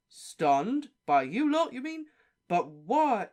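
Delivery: high, loud incredulous questions; shocked disbelief. Acted. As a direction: sound stunned by the news.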